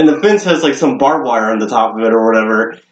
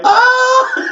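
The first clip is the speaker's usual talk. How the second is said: very high comic shriek; high, laughing scream of retold fright; imitated squeal of panic; mock scream.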